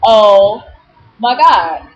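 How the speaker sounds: a loud, outraged "Oh my God"; loud exclaim. Retold or imitated. Telling her assistant what happened, a loud disgusted exclaim.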